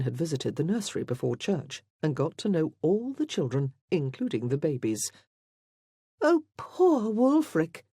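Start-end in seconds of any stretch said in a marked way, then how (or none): 6.2-7.8 high, drawn-out, loud lament; crying out. A narrator's reading. Lady Elaine pities Wulfric before everyone, crying out. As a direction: exclaim with theatrical pity, slowly. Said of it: "Lady Elaine cried".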